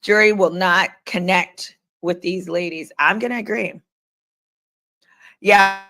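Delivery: normal talking voice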